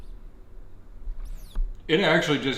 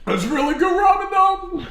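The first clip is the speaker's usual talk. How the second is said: strained pained voice; 5 dB louder than usual. Real, not acted. Forcing down painfully spicy ramen.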